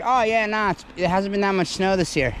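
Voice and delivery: a dig at his own casual overconfidence; mocking himself. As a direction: play your naive earlier self, lightly high. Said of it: "Right when I'm like".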